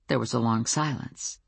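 ordinary delivery

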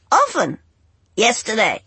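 high, loud squawks; squawking bird. A narrator's reading. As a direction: squawk like a seabird. Angel bird answering.

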